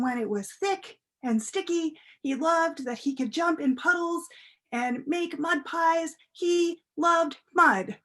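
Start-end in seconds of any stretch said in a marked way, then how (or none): 6.3-8.0 slow relish. A narrator's reading. Relishing the child's love of mud.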